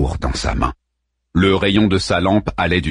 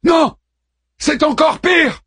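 alarmed shout. A narrator's reading. Harman shouts back alarmed.

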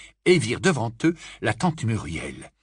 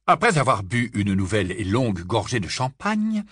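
ordinary delivery, nothing marked

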